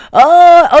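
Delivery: loud, high drawn-out cringe; cringing "oh". Real, not acted. Man in the background hears the awkward story, cringing. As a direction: let out a pained, cringing "oh". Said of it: "that was [name] cringing in the background".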